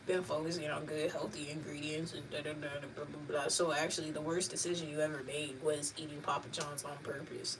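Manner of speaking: put-on deadpan older brother explaining the facts; expressionless know-it-all. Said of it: "No expression on his voice".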